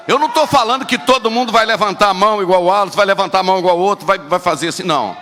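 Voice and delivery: loud, fast preacher's exhortation; shouting from the pulpit; fiery preaching. Real, not acted. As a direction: drive it home to the congregation. Preaching at full force.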